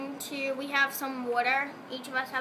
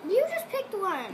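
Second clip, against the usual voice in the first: bickering; 6 semitones higher, slower than usual. Real, not acted. Bickering with mom over picking.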